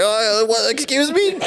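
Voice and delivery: way up high, an offended squeak; mock a man who is suddenly flustered and outraged; mock prissy indignation. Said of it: "He thought he was gonna like".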